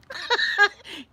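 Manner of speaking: high burst of laughter; real giggle at the absurdity; amused laugh. Real, not acted. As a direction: laugh at your own joke. Laughing at dad's supposed ambition.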